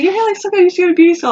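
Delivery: imitating a high, gushing compliment; mock gushing stranger, pitched well up; gushing admirer. Strangers praising her dyed hair, high mock gushing.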